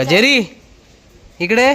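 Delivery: high, rising call to a pet; calling the dog. Real, not acted. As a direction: call the dog over with a treat.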